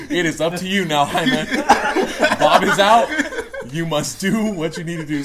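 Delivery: hammed-up dying leader's last orders; mock-solemn charge. Acting out the fallen leader's charge.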